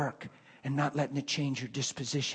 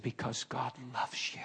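soft hushed whisper, softer; 2 semitones lower, 10 dB quieter than usual. Retold or imitated. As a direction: breathe it gently like a secret. Tender revelation to coworker.

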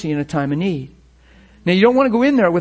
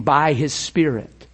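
ordinary delivery

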